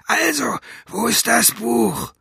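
high, rough villain's voice; rough cat-tongue voice. A narrator's reading. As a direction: rasp it like a cat's tongue. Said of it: "Das war wieder die andere Stimme". (raue, hohe Katzenzungenstimme)

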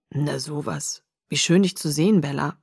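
lightly voiced father, low and soft; a hint of a low, soft-spoken dad; older father figure. A narrator's reading. Billy greets his visitor.

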